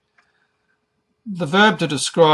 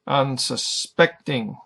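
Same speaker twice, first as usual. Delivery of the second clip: Unsuspecting slowly, with SPECT stressed; stress on third syllable; slower than usual. Shown as a demonstration. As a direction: break up Unsuspecting and lean on SPECT. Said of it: "third syllable is emphasized".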